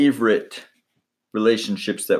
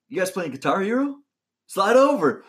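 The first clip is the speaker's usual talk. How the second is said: higher-pitched, eager invitation of himself; Dwight Howard; 10 semitones higher than usual. Retold or imitated. Young player barging into locker room to join game, eager. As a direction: imitate a keen player butting in. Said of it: "Dwight Howard comes in".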